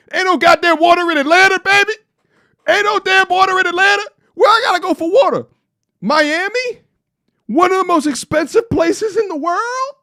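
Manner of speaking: griping about no beach in Atlanta, shouting high; exasperated shout. Real, not acted. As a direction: yell it like you can't believe it. Shouting complaint about Atlanta water.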